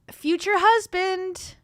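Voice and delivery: mocking repeat. Streamer echoing the caption back in sarcastic disbelief. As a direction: stretch it out in disbelief.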